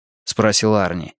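ordinary delivery, nothing marked

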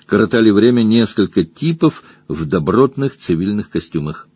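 ordinary delivery

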